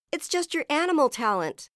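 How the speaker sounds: high, loud explanation; shouting. A narrator's reading. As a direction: shout it up to the rooftop. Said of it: "Uma shouted".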